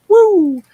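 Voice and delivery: loud, high-pitched "woo"; quick swooping "woo" sound effect; high whoosh. Shown as a demonstration. Showing a slide back into the old voice, high woo.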